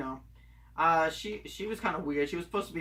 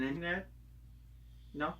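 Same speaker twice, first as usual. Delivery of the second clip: ordinary talk, nothing marked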